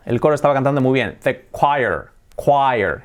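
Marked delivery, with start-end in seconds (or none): 1.2-3.0 choir as KWAI-er, drawn out; pronunciación correcta. Shown as a demonstration. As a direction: model the kw sound in "choir". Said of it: "Ese sonido". (choir como 'kuáier')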